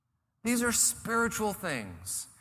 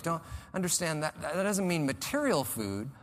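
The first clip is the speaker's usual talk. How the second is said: ordinary talk, nothing marked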